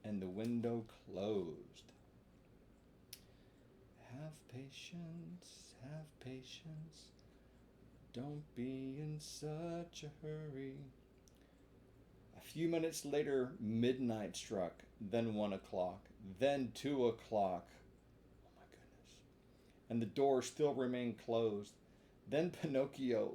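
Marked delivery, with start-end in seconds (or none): 4.1-10.8 slow, soft coaxing; calm the listeners down softly; soft slow reminder; gentle aside. A narrator's reading. Narrator aside to children. 18.5-19.1 a hushed whisper; breathy whisper aside. A narrator's reading.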